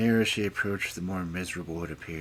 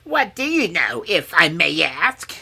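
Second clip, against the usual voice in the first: high, loud crone voice; scornful old dame; 13 semitones higher, 13 dB louder than usual. A narrator's reading. Old dame quizzing the penniless princess, scornfully.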